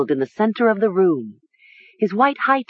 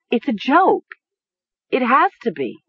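high, desperate insistence; insistent; 7 semitones higher than usual. A narrator's reading. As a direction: insist as if convincing yourself. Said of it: "she insisted".

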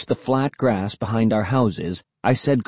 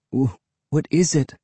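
stammering; 2 semitones higher than usual. A narrator's reading. Will facing the gruesome sight, stammering. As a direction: stammer on the first word. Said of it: "Will stammered".

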